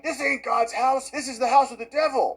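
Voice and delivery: high-pitched imitation of a yelling protester; put-on high yell, no real volume; mock yelling. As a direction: cry it out high, mocking the heckler. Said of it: "can be heard yelling".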